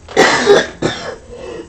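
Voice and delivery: harsh chesty cough. Real, not acted. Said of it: "All the perils of live streaming".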